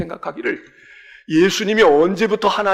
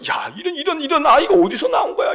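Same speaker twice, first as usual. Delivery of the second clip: amazed exclamation; 7 semitones higher than usual. Acted. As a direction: marvel at the boy aloud. Rabbits amazed, preacher shouts.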